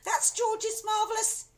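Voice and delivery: very high, urgent, cut off; cut-off cry. A narrator's reading. Daughter warning mother, cut off. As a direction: blurt it before interruption.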